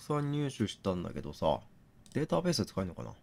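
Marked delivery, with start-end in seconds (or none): none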